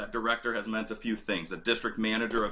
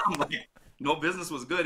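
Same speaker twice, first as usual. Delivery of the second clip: laughing; 8 semitones higher, faster than usual. Retold or imitated. Retelling own protest while laughing.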